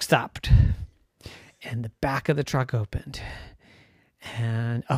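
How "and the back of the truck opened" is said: usual speaking voice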